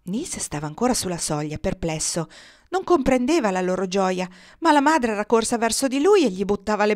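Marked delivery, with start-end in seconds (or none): none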